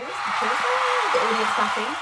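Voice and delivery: mock announcer hype for "the chairs"; cheering crowd. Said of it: "This is when I insert".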